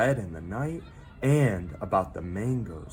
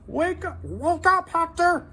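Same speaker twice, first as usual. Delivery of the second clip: high, pleading, louder wake-up call; urgent high little voice; 6 dB louder than usual. A narrator's reading. Minton urging Hector to wake, high and urgent. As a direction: shake him awake with high urgency. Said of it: "urged Minton".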